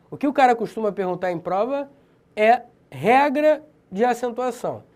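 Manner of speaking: ordinary talk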